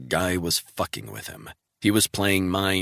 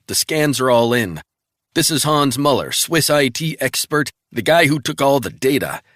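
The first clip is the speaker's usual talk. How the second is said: brisk, higher voice for the colleague; crisp agent reporting in; colleague on the phone; 6 semitones higher, 6 dB louder than usual. Acted. Travell briskly reporting in to Resnick by phone.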